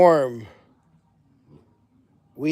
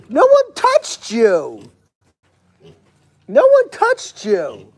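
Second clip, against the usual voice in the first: high, loud, drawn-out words; slow, high protest to a fussing animal; chiding a squealing animal; 5 semitones higher, 5 dB louder, much slower than usual. Real, not acted. Keeper answers animal's squeal after a bite, chiding high and slow.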